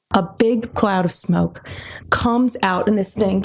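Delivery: usual speaking voice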